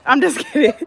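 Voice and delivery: laughing it off while teasing. Real, not acted. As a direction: giggle through the joke.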